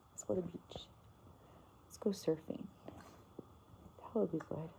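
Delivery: soft whisper to a sleepy pet; hushed bedtime whisper. Real, not acted. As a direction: whisper it so the dog drifts off. Lulling dog to sleep.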